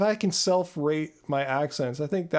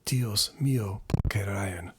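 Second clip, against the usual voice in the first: low, sultry Spanish accent; sexy Latin accent; 7 semitones lower, slower than usual. Shown as a demonstration. Streamer trying the accent on viewers, low sexy Latin accent. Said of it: "my sexy Latin accent".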